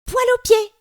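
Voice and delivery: very high, loud cheeky yell; a squealing mocking shout. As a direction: shriek the rhyme at the top of your voice. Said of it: "hurle Zékéyé".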